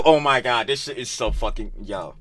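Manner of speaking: ordinary talk, nothing marked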